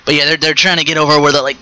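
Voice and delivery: ordinary talk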